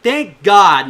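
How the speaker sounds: a burst of loud relief; shouting thanks in relief. Retold or imitated. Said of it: "I was like".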